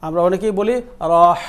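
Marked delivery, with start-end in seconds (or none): 1.1-1.4 "রহ" with the vowel drawn out before h; mispronounced rah. Shown as a demonstration. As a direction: stretch "রহ" into a long "ro-oh". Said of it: "এটা ভুল". ("রহ"-এর র টেনে ভুল উচ্চারণ)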